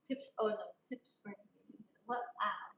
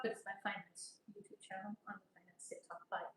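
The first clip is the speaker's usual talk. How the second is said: unvoiced, hushed aside; whispered aside; 3 semitones lower, 11 dB quieter than usual. Real, not acted. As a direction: whisper the correction. Quick muttered clarification.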